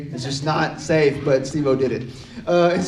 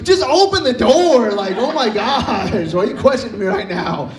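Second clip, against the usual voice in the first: exasperated yell; 5 semitones higher, 4 dB louder than usual. Acted. Locked-out kid to his questioning brother, exasperated yell.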